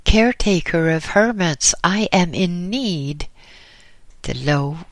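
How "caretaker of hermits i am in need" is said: loud, high call to the door; loud, pitched-up hail at the hermitage door; calling out loudly. Acted. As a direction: call out to be heard inside. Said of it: "as he called out loudly".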